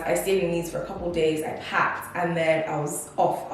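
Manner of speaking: ordinary talk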